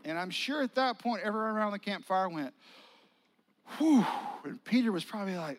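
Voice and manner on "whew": loud, high whew of relief; a big relieved whew. Acted. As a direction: let out the held breath. Campfire relief after tension.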